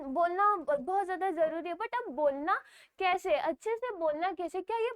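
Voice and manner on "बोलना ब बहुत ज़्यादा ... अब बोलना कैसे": normal talking voice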